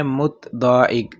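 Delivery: slow, careful word; slow demo. Shown as a demonstration. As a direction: say it slowly to memorise. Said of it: "متضاحک بھی آپ کہہ سکتے ہیں". (آہستہ لفظ)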